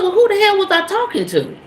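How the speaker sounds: indignant retort. Retold or imitated. Retold angry exchange at window.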